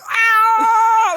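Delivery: high loud bug scream. Pretend. Bug being wiped off the windshield, loud scream.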